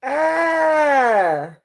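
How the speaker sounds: sudden high loud cry of surprise; a sharp, high startled "Ah!"; startled shout. Real, not acted. Sudden shout in tarot reading.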